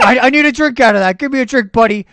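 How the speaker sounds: loud hammed-up bum voice asking for booze; imitating a drunk bum. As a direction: ham it up as a pushy drunk. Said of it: "hired some guy to play as a bum".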